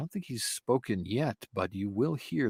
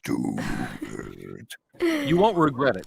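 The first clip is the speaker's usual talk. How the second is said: demon voice; 10 semitones lower, 5 dB louder than usual. Pretend. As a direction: tempt them in a low demon croak. Demon voice urging him to play.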